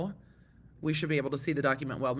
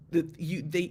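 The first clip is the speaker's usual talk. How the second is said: ordinary talk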